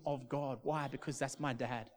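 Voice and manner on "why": usual speaking voice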